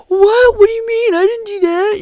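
high, loud mock-innocent kid protest; whiny kid denial. Kids protesting to the substitute teacher, high loud whine. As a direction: squeal it like a caught schoolkid playing innocent. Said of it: "same, same exact attitude".

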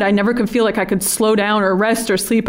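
ordinary delivery

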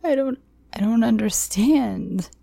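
high, tearful, halting plea; tearful pleading. A narrator's reading. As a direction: plead through tears. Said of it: "Tears streamed down my face".

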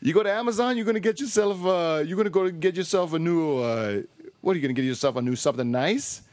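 mock Italian salesman; Italian accent. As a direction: coax them in a put-on Italian accent. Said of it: "That was my Italian accent".

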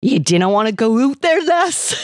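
high, urgent Scottish warning; Scottish accent. A narrator's reading. Kilted man warning Amanda at the door, urgent Scottish accent. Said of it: "he said fervently in a thick Scottish accent".

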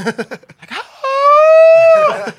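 high loud shrieking laugh; release laugh. Retold or imitated. Comic imitates the man's release laugh, shrieking. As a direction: let out a piercing cathartic whoop of a laugh. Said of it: "He has a laugh that's above everybody else".

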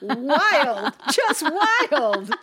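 loud laughing exclamation. Real, not acted. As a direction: laugh the words out. Reacting to funny mix-up.

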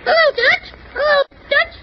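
high squawking parrot voice. Acted. Parrot answers the man coaxing it, high parrot squawk. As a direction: squawk it like a talking bird.

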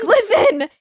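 exasperated urgent plea; urgent insistent repeat. Retold or imitated. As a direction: insist harder the second time. Insisting again, more urgently.

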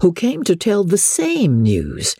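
usual speaking voice

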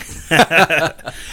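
sudden loud real laugh. Real, not acted. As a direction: burst out laughing. Breaks off the radio voice.

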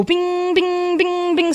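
high, ringing bings; bing-bing-bing sound effect; imitated chime. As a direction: voice a string of little chimes, each bing held. Imitated chime of lights appearing.

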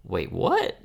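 slow, incredulous, the pitch lifting at the end; stunned disbelief. Retold or imitated. Friends hearing the untold truth, slow incredulous rise. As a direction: quote the friends' disbelief, rising high on the last words. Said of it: "and we all were like".

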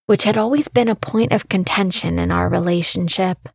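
ordinary delivery

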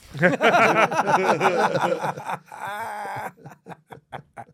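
long high laugh. Real, not acted. Cracking up at own story.

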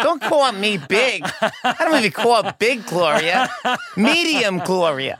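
imitating mom's high-pitched objection; indignant mom. Mom's indignant protest in a story.

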